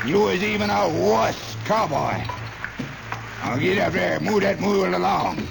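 put-on high hollering old man driving a mule; bossy old father. Father scolding his son while driving a mule, high hollering. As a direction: holler like a tough old patriarch.

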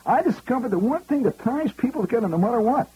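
high, brash Bronx New York voice; Fordham Road Bronx accent. Retold or imitated. Tipsy executive boasting to comic, in Bronx accent. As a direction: drop the English polish, go full Fordham Road. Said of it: "he's talking like he's from Fordham Road".

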